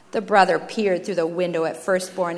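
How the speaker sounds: plain reading voice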